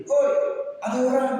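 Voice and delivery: high acted shout for help; calling out. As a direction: call up the cliff for anyone. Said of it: "Dia teriak lagi".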